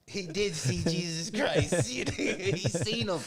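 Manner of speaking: slow, high, laughing delivery; laughing through it. Real, not acted. Cracking up at his own punchline, slow and laughing. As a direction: stretch it out while laughing.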